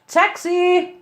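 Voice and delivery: a sudden high hail across the station; crying out for a taxi. A narrator's reading. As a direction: call out to grab a cab and dodge the subject. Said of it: "Lancer cried".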